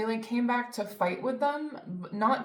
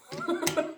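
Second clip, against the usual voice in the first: short high laugh; 8 semitones higher than usual. Real, not acted. Laughs at own anger.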